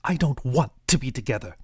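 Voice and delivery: snapped. A narrator's reading. Angry mother snaps at the father. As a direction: cut him off hard. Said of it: "she snapped".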